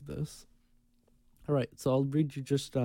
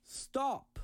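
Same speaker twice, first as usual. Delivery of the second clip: mock yell; 13 semitones higher than usual. Reading out a passenger's warning to the pilot, mock yell. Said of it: "he heard someone yell".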